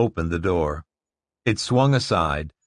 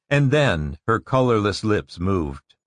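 ordinary delivery, nothing marked

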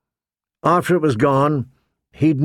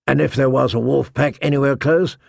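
usual speaking voice

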